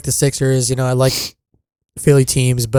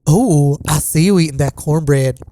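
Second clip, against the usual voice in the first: effeminate Southern voice climbing much higher; Southern church potluck woman; 4 semitones higher than usual. Shown as a demonstration. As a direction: gush like a Southern lady sharing her recipe. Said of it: "the Southern woman at the church potluck".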